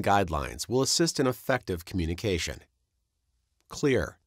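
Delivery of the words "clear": usual speaking voice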